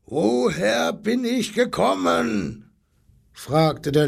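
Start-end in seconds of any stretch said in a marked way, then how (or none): 0.1-2.7 high, slow, roared question; brüllender Löwe. A narrator's reading. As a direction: bellow it drawn out, demanding to know. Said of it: "Da brüllte der Löwe so lange". (hoch und langgezogen gebrüllt)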